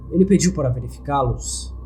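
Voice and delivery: ordinary delivery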